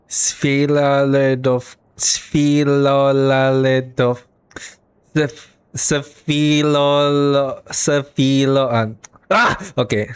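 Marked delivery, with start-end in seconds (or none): 0.1-8.7 slow, drawn-out stabs at "Svilaledov-, Sviloladov-, Sv-, Svilo-"; halting pronunciation attempt. Real, not acted. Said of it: "gonna attempt it again". 9.3-9.6 short exasperated "ah!"; frustrated cry. Real, not acted. Gives up on hard name.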